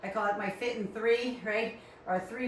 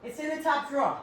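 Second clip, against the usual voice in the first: higher, "drawer" said as "draw", Rhode Island style; Rhode Island accent; 5 semitones higher than usual. Shown as a demonstration. Showing how she says it herself, Rhode Island accent. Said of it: "And I say".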